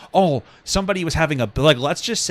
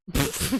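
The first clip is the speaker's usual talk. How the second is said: short laugh; 6 semitones higher, 8 dB quieter than usual. Real, not acted. Laughing at own mock.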